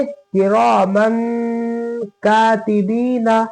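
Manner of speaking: किरामन कातिबीना slowly, nasal on the न of किरामन; nasal ghunna. Shown as a demonstration. Teacher recites for students, nasal and slow. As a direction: hum the ending of किरामन. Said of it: "तो कैसे पढ़ेंगे".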